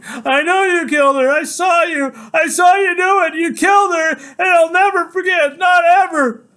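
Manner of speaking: high, full-voiced screamed accusation; screaming. A narrator's reading. Son accusing his father of Mom's killing, relentless screaming. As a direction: keep screaming it, every phrase at the top. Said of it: "all I can do is keep screaming".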